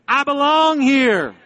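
high, loud, long-held proclamation; rallying declaration, bigger. Shown as a demonstration. Preacher has congregation say it again, high ringing slow proclamation. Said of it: "Say it again".

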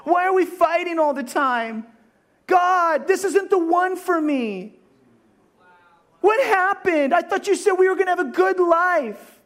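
a theatrical whining complaint to God; mock crying. Spouse complaining to God about marriage, hammed-up wailing. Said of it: "And then you're crying".

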